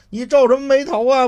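loud, high, mocking interrogation; stern questioning. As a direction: needle him from above. Master interrogates the thug.

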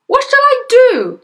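very high, loud wail; wailing father. Acted. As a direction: wail it in helpless panic. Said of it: "wailed the father".

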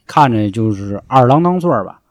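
ordinary delivery

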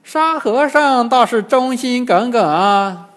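put-on high, slow, loud old demon drawl; unangered old demon. A narrator's reading. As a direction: remark on it at leisure as an old demon. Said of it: "金蝉老妖并不发火，". (老妖不发火，拖长声音说)

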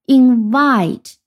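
in-VITE, weight on the end; stress on second syllable. Shown as a demonstration. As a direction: Invite with VITE stressed, long and loud. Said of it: "它的重音在第二音节".

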